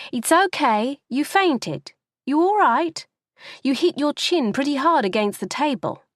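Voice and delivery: put-on high, brighter young woman's voice; Olivia's voice. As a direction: reassure her as a cheerful teenager.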